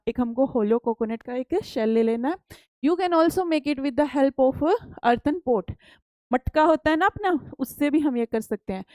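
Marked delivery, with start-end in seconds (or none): 2.9-5.8 reads each English word out slowly and carefully; slow textbook reading. Acted. Teacher reading the textbook aloud to pupils, slow and deliberate. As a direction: spell out the book line for the class.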